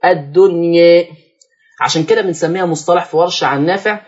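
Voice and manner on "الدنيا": "الدنيا" with the final a tilted slightly to e; taqlil. Shown as a demonstration. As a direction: draw out "الدنيا", its last alif leaning toward e. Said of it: "أميل بالألف شوية".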